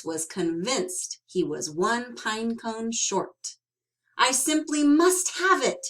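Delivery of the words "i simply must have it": wistful cry. Acted. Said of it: "he wistfully cried".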